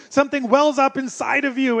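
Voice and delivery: ordinary talk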